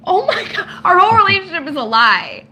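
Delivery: high, loud mock shock; playfully scandalized outburst; mock outrage. Real, not acted.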